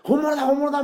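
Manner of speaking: excited shout. Retold or imitated. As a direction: shout like a fan who met the real star. Fans thrilled at real singer.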